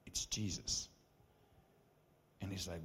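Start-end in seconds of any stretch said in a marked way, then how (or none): 0.1-0.9 soft whispered insistence. Retold or imitated. Reassuring his friend in a whisper. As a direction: breathe it out gently. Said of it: "I was like".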